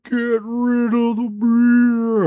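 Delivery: very slow, high drawn-out command; slow-motion talk. Retold or imitated. Buddy telling him to get rid of the beer, absurdly slow. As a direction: stretch every word out in a high mock voice. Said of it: "Why are you talking so slow?".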